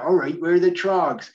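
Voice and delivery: a quick mock West Country voice, a bit piratey; West Country accent. Host imitates the Troggs arguing in the studio, mock West Country accent. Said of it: "they all talk like that".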